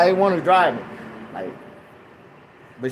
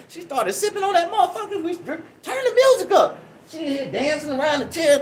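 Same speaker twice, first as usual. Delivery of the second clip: ordinary talk, nothing marked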